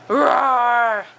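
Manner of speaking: playful monster growl; monster roar. Pretend. Parent voicing monster for toddler.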